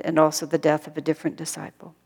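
ordinary talk, nothing marked

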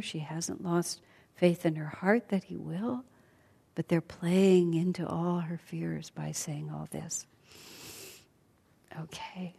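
ordinary delivery

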